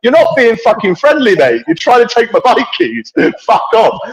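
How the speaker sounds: high-pitched, exasperated retold telling-off; retold indignant outburst. Rider rebukes the man who took his keys, retold indignantly. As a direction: act out telling him where to go. Retells angry row over bike keys.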